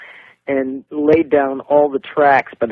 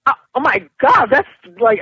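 shocked gasp; 8 semitones higher than usual. Retold or imitated. Singer stunned by her producer's personal question, flustered. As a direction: caught off guard and embarrassed, blurting. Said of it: "I was blown".

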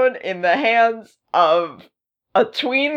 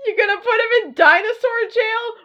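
incredulous; 13 semitones higher than usual. Real, not acted. Mocking incredulous questions.

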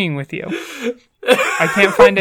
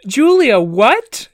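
shocked question; 7 semitones higher, 5 dB louder, much slower than usual. Retold or imitated. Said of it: "And I go".

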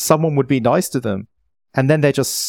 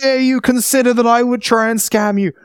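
mock indignation; 7 semitones higher than usual. Mock outrage at scam accusation.

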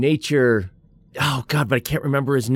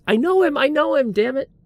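high frustrated mock. Frustrated self-quote, high voice.

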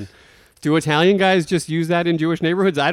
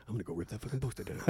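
muttered resolve; 6 semitones lower, 21 dB quieter, much faster than usual. Retold or imitated. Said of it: "He's like".

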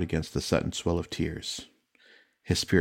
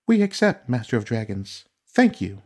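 eager thanks; 5 semitones higher, 5 dB louder than usual. Acted. Accepting the dragon master's offer.

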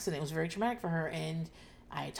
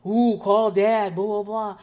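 loud, drawn-out imitation of a yelling kid; mock screaming; 3 semitones higher, 11 dB louder, slower than usual. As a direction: holler it, stretched out, mimicking him. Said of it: "he just starts screaming".

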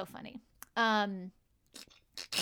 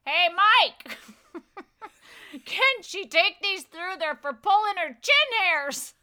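loud, higher call across the room; retold yell; 7 semitones higher, 5 dB louder than usual. Security guy yelling to his supervisor across the checkpoint, loud call. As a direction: holler it over to the boss. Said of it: "he yells across to his supervisor".

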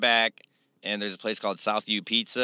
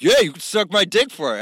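higher, louder mock taunt; taunting dare; 9 semitones higher, 7 dB louder than usual. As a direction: throw the dare back at him. Retold guy taunting him.

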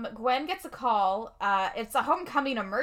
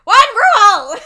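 much higher, much louder mock shout; shouted catchphrase; 11 semitones higher, 19 dB louder than usual. Echoing cohost's quote of the show's rule, gleeful mock shout. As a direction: belt the catchphrase out.

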